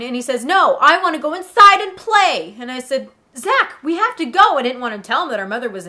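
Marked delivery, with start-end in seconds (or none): 0.4-2.5 loud, higher mock kid protest; hammed-up childish demand; bratty little brother. 3.4-4.6 exasperated big sister. Retold or imitated. Sister urging brother.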